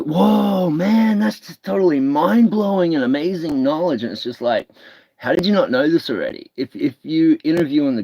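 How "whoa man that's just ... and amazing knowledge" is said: high, amazed stoner voice; Joe Rogan impression. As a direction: gush like a wide-eyed podcaster. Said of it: "he'd be like".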